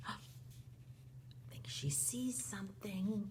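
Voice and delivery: gasp, then slow hushed whisper; slow, very soft storytelling hush; suspenseful whisper. Acted. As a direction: draw it out softly to build suspense. Storyteller builds suspense for children.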